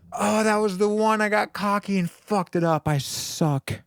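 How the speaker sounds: usual speaking voice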